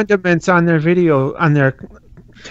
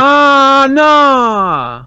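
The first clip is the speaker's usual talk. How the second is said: loud, high drawn-out groan; dismayed cry; 11 semitones higher, 7 dB louder than usual. Real, not acted. Dismayed reaction to the condom.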